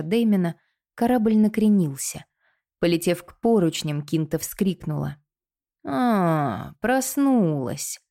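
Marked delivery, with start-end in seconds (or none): 5.9-8.0 slow, higher mocking greeting; sly drawl. A narrator's reading. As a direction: drag it out, teasing the captive. Villain greets captive, lightly voiced.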